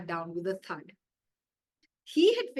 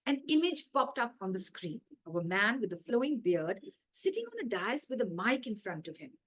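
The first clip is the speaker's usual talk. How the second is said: usual speaking voice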